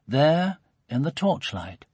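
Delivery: usual speaking voice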